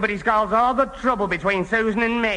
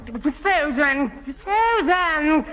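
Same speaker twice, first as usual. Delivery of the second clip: calling after her; 5 semitones higher, much slower than usual. Acted. Alfie calling after Susan.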